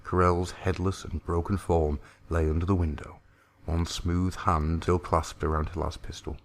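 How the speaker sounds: ordinary delivery, nothing marked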